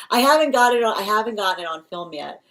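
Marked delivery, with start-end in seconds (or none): none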